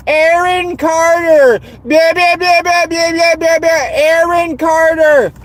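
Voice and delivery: high, drawn-out name with silly babble; mocking Brits and wine moms. Mimicking British voice-chat regulars and wine moms, mocking. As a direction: squawk it like a mindless wine mom. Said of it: "interrupt them going".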